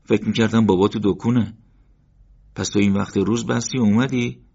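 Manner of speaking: a touch of surprise at his brother; surprised. Acted. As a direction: question it in disbelief. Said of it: "با تعجب".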